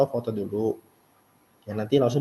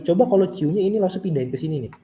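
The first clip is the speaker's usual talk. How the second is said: normal talking voice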